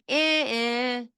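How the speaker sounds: just the vowel "eh", no consonants; bare vowel sounds. Shown as a demonstration. Therapist imitating a child's vowel-only speech. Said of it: "You're hearing".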